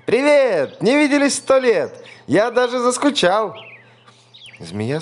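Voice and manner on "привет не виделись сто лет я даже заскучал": very high, loud bright hello; cheery mouse greeting. A narrator's reading. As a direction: greet an old friend with delight.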